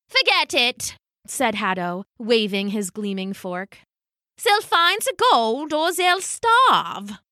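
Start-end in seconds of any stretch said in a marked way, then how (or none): 0.1-1.0 dismissive. Acted. Haddo dismisses Hans's offer. 4.4-7.2 put-on high, slow, accented villain; haughty dismissal. Haddo to Hans about the starving crowd, haughty and dismissive.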